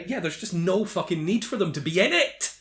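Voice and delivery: ordinary talk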